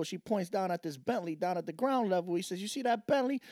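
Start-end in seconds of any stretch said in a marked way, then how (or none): 2.6-3.4 higher, showing off; lightly voiced proud woman; woman's voice. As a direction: voice her, pleased with herself. Joke, woman voiced high.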